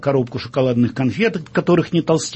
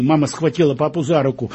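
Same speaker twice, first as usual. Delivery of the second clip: ordinary delivery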